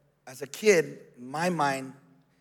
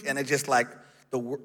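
normal talking voice

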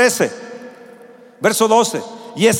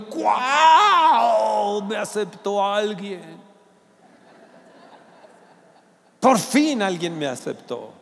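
softer, half-laughing, half-tearful joy; overjoyed groom; 3 semitones lower, 6 dB quieter, slower than usual. Retold or imitated. Pastor retelling his engagement to the church, softer tearful joy. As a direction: recall the joy of being accepted, choked up. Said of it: "estaba yo súper emocionado".

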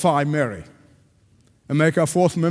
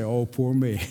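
mock self-pity; 6 semitones lower, 4 dB quieter than usual. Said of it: "you'll never say".

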